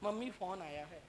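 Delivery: low, very quiet call to mother; softly spoken. Acted. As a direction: say it too softly to reach the roof. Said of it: "कि धीरे धीरे बोलेगी".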